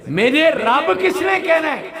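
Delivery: slow, high, loud exclamation; God's proud declaration. Acted. God declaring forgiveness to the angels, slow loud cry.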